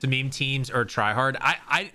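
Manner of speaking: usual speaking voice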